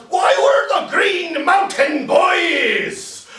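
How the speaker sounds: high, loud, drawn-out shout; proud cry. Acted. Troops answering the old guy, high stretched-out shout. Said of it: "we all cry out".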